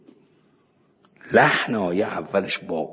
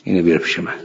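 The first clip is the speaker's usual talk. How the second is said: normal talking voice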